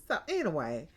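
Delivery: normal talking voice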